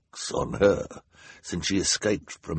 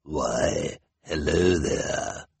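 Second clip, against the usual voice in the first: slow, drawn-out sinister greeting; low, menacing villain's drawl; 3 semitones lower, much slower than usual. A narrator's reading. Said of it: "in a sinister voice".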